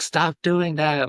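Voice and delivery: high, slow, panicked plea; drawn-out cry of a cornered creature; depression freaking out. Retold or imitated. Depression panicking as he hugs himself, high and drawn out. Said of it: "depression was freaking out".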